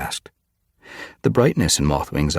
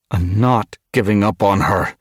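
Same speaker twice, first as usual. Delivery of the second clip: loud, raised, heated refusal; hotly; 6 semitones higher, 6 dB louder than usual. A narrator's reading. Mothwing refuses to give up on Frostpaw, hotly. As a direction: say it hotly, with defiance. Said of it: "Mothwing mewed hotly".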